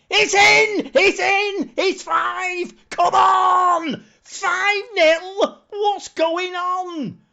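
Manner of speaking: high, loud, crying-out celebration; screaming with excitement. Real, not acted. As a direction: lose it completely as the fifth goal goes in. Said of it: "Oh, I need to calm down".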